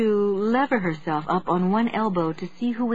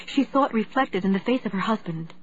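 plain reading voice

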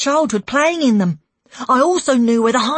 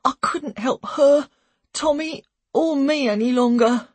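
ordinary delivery, nothing marked

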